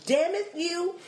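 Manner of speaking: high, over-careful mock outburst; prim mother trying to swear; inept cursing. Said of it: "My mother tries to cuss out".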